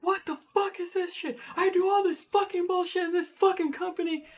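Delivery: very high, strained tantrum voice; re-enacted high-pitched rant; over-the-top angry. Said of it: "I'm just so over the top angry".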